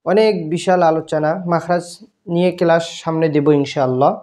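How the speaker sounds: ordinary delivery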